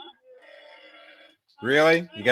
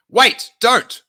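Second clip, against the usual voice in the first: higher, mildly urgent warning; 9 semitones higher, 3 dB louder than usual. Acted. Luke warns the group about the bait, urgent warning. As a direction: call out a quick warning. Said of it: "warned Luke".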